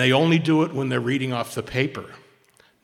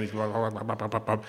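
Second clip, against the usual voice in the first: mock droning blah; 3 semitones lower, 4 dB quieter than usual. Mimicking droning speaker.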